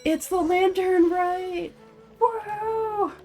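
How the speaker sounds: high, drawn-out singsong cheer; excited cheer. Real, not acted. Streamer greeting the lantern rite in game, excited cheer. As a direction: stretch it out high into a woohoo.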